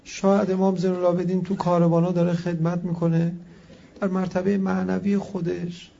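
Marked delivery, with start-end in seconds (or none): none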